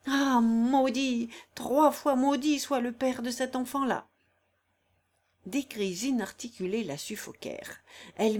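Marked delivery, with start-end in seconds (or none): 0.1-4.1 exasperated cry. Acted. Corilla curses the father in agony, exasperated. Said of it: "s'écria dans un de ces mouvements exaspérés".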